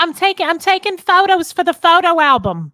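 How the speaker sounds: high, loud put-on tourist voice; chirpy visitor snapping photos; fake cheerful tourist. Playing undercover visitors.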